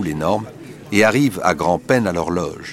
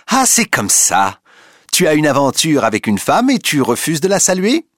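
shouting; 8 semitones higher, 5 dB louder than usual. A narrator's reading. Rachel shouting at Duroy before the crowd, outraged. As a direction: hurl the accusation at him. Rachel s'arrête soudain de crier.

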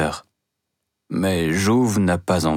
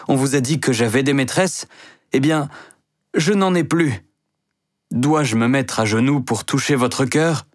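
desperate declaration; 6 semitones higher than usual. Acted. Mouret begs Denise for her love, high and impassioned. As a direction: lay his heart bare, pleading.

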